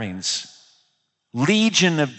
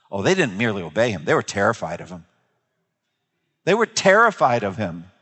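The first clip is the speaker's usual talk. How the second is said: normal talking voice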